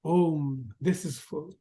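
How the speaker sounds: ordinary talk, nothing marked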